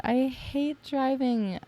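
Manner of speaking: "I hate driving" sung out high and slow, touched. Retold or imitated. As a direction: let "I hate driving" float up, moved and grateful. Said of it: "And I'm like".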